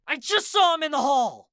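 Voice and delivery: high, loud furious scream. A narrator's reading. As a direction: keep screaming at him. Continues screaming at Mad Dog.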